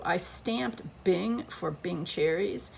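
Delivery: ordinary talk, nothing marked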